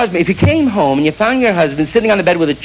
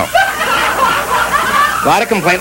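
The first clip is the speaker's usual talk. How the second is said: stretched way up high and slow, laughing; mock high drawn-out; much slower than usual. Comic mocking the law, high and slow.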